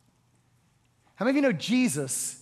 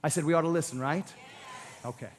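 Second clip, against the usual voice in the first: ordinary delivery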